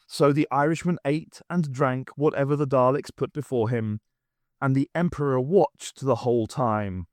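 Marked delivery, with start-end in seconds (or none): none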